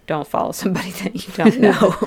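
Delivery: amused, laughter bubbling up mid-sentence; laughing. Real, not acted. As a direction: laugh through the advice. Laughs while giving obvious advice.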